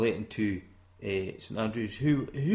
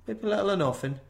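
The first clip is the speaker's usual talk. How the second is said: playful high emphasis; 10 semitones higher, 6 dB louder, faster than usual. Real, not acted.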